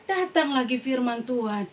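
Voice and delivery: normal talking voice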